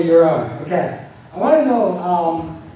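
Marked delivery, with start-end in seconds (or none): none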